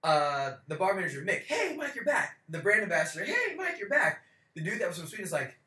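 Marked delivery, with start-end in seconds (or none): 1.4-2.4 bright mock barman hello; cheery greeting. Bar manager greets him. 3.3-4.2 bright mock barman hello; cheery greeting. Brand ambassador greets him.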